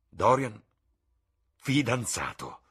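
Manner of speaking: loud, slow, disbelieving cry of the name; Basil shouts out in disbelief; shocked shout. Acted. The painter hearing of Dorian's engagement, shouting in shock. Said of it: "gridò".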